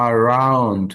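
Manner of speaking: slow "around" with the r sounded; model word. Shown as a demonstration. Teacher modelling "around" for the class. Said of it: "আউ এই সাউন্ডটা আছে".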